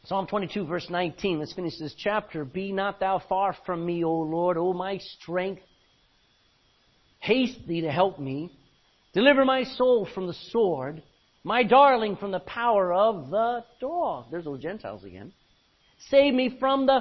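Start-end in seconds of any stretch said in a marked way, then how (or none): 2.5-14.3 loud, declaiming King James reading; scripture reading. Acted. Preacher reads Lamentations to congregation, declaiming. 16.0-17.0 loud, declaiming King James reading; scripture reading. Acted. Preacher reads Lamentations to congregation, declaiming.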